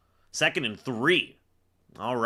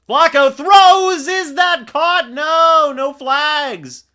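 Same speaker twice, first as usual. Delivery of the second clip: very high, loud, stretched shout; real excited sportscaster yell; shouted play call; 13 semitones higher, 12 dB louder, much slower than usual. Real, not acted. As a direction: shout the deciding throw as it happens. Live call of deciding throw.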